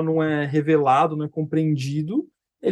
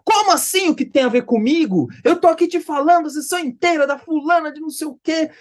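higher, heated mock outburst; stormy indignant client; 9 semitones higher than usual. Client protesting when asked what this has to do with her, stormy indignation. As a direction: fire back like an affronted client. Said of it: "Virou um tufão a sessão".

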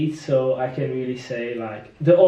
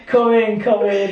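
teasing invitation; 5 semitones higher, 3 dB louder than usual. Retold or imitated. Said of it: "you just tease them, like".

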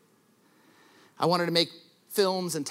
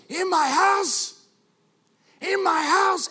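high, loud, slow furious shout; shouting mobster; 12 semitones higher, 5 dB louder, slower than usual. Retold or imitated. Preacher acts the mobster's rage for junior highs, hammed-up shout. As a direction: bellow it like the enraged Godfather. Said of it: "And he goes".

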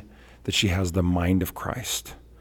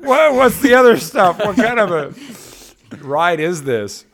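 higher, laughing, slowing to a crawl on the last question; hammed-up startled driver; 11 semitones higher, 7 dB louder, slower than usual. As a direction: play the baffled stranger, half laughing.

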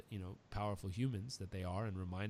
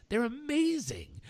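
much higher, louder, slow and drawn-out "amazing"; gushing excitement; 10 dB louder, slower than usual. Retold or imitated. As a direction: gush about finding your people. Quoting himself overjoyed.

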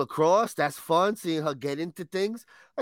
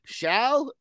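questioning; 8 semitones higher than usual. Shown as a demonstration. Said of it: "I don't know about this word here".

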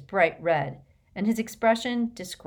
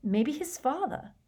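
thoughtful; 9 semitones higher than usual. A narrator's reading. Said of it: "apparently lost in thought".

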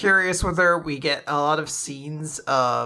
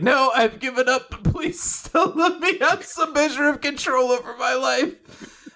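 mock defeated plea; 9 semitones higher than usual. Teacher pleading defeated.

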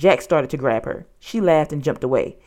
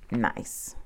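whispered, low-key admission; hushed, reluctant note to self; grudging note; 6 semitones lower, 10 dB quieter than usual. A narrator's reading. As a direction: mutter it under your breath as you write. Jack grudgingly writes a note.

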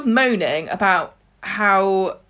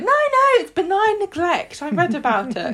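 higher, airy know-it-all mum; breezy Audrey; 10 semitones higher than usual. Retold or imitated. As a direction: dismiss it proudly, light and high. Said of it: "Audrey's like".

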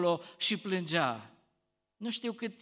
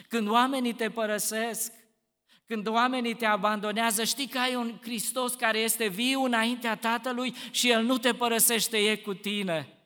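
louder, urgent preaching push; rising sermon; 5 semitones higher, 7 dB louder than usual. Acted. Preacher comforting the abandoned, louder and urgent. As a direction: lift them with certainty that Christ stays.

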